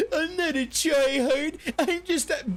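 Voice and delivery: high, mocking voice breaking into laughter; laughing mock voice. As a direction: mimic them while cracking up. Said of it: "where I told them".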